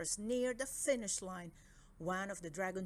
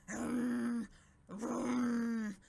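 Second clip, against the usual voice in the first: grumpy hum, a bit louder; grunting hum; 2 semitones higher, 5 dB louder than usual. Acted. King grunting at the winners, grumpy hum. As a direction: grunt doubtfully. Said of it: "grunted the Dragon King".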